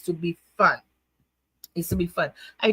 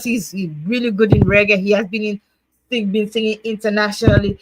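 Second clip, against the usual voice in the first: usual speaking voice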